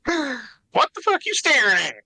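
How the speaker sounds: hostile high snap; aggressive weasel voice. Acted. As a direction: pick a fight, cocky and rude. Duke snapping at Brian.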